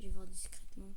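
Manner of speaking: hushed, cautious whisper. Real, not acted. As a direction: whisper it, sneaking up. Sneaking to check a customer.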